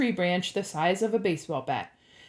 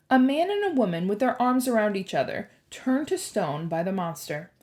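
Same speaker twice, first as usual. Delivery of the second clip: plain reading voice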